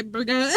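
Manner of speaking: burger said as BUR-guh, no final R; non-rhotic BUR-guh. Shown as a demonstration. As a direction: drop the R at the end of burger. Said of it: "how to say burger".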